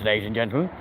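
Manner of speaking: normal talking voice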